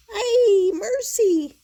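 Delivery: high, loud cartoon wail; alarmed cry. A narrator's reading. Kitty dreading the brushes, hammed-up alarm.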